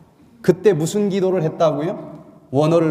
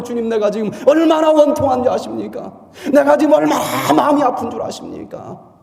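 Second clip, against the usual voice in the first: aggrieved prayer; 6 semitones higher, 4 dB louder than usual. Pretend. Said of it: "원통하면 원통한 나름대로".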